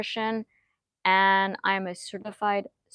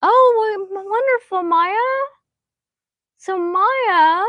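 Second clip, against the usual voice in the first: high, loud burst of delight; light up at good news from a viewer; thrilled reading a viewer's comment aloud; 11 semitones higher, 9 dB louder, slower than usual. Real, not acted.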